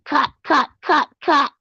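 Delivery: high, shrieking imitation; mock scream. Mimicking the rival screaming at Debbie. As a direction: mimic someone screaming cut. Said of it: "screaming at Debbie".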